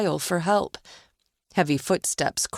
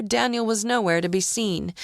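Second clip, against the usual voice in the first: usual speaking voice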